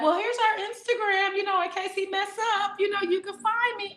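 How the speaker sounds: high mock flirt; flirty. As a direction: sweetly hand out the Instagram. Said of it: "We might be like".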